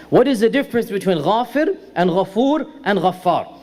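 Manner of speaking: spaced out, each name set apart; measured repeat. Real, not acted. Lecturer repeating the question for the class, measured and deliberate. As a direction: repeat the question deliberately, separating the three names.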